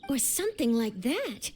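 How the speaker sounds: low, doubting question; sceptical echo. Acted. Journalist challenges a witness's vague recollection, sceptical. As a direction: throw the words back in doubt, pitched low.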